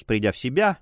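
usual speaking voice

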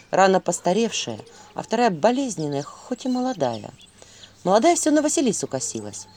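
ordinary delivery, nothing marked

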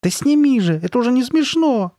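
put-on very high, loud little-boy yell; high-pitched frantic demand. A narrator's reading. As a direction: shout it upset and cross, at the end of patience. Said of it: "заорал Ноа".

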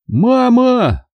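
high calling shout for mom. A narrator's reading. Boy calls his mother from bed, high calling shout. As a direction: call out for mama, long falling.

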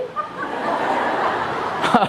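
high breathy laugh. Real, not acted. Joke about Americans.

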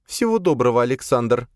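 high, cold farewell; frowning Sofia. A narrator's reading. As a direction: say goodbye with a frown, pitched high. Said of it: "нахмурилась она". (холодно, высоким голосом)

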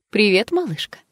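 mocking fortune teller hello. A narrator's reading. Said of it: "Насмешливо смотрит на Джесс гадалка". (насмешливо)

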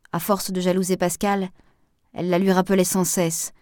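ordinary delivery, nothing marked

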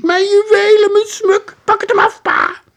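frantic mother's very high shriek; piercing, loud falsetto wail; cried out. A narrator's reading.

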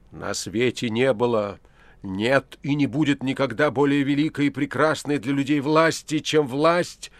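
Pilate's broken voice grows into a shout; swelling hoarse outcry. A narrator's reading. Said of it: "Сорванный и больной голос Пилата разросся".

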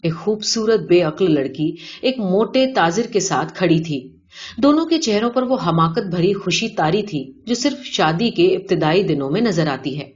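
ordinary delivery, nothing marked

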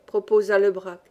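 plain reading voice

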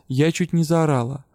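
ordinary delivery, nothing marked

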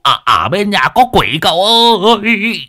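high panicked shout for help; crying for help. Acted. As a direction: yell for help, terrified. Man running in crying for help.

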